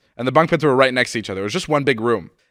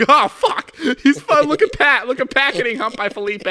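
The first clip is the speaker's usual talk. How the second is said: laughing; 7 dB louder than usual. Retold or imitated. Laughing at friend's bunk.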